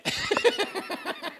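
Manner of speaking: high, soft real chuckle; laughing. Real, not acted. Preacher laughing at his own joke to the congregation, chuckling.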